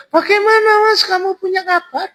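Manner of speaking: very high falsetto woman; high put-on female voice; woman's voice. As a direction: pitch way up as the woman answering. Voicing the veiled woman.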